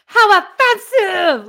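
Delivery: outraged exclamation; mad. Shown as a demonstration. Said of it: "there was an exclamation point".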